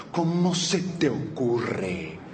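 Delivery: low, soft, hugely drawn-out outrage; slow theatrical "how dare you"; mock indignation. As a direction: drag each word in hammed-up disbelief. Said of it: "Cuánta indignación".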